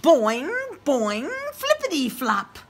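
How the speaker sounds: high, lively boing boing; bouncy sound effect. Acted. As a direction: bounce the words like a spring. Reads bouncy sound words.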